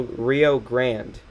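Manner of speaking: GRANDE said as English "grand"; one-syllable GRANDE, like "grand"; mispronounced GRANDE. Real, not acted. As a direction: drop the final vowel of GRANDE. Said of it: "forget how to pronounce Grande".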